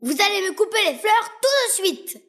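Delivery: prince shouting. Acted. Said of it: "cria le fils du roi".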